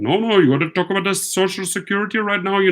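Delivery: imitating an Indian accent, fast and high; mock Indian call-center scammer voice, rushed. Scammer on the phone to him, in a mock Indian accent. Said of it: "in an Indian accent".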